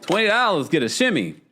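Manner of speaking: party shout for a twenty dollar tip; sing-song shimmy call. Shown as a demonstration. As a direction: announce the shimmy like a little cheer. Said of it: "I forgot to shimmy".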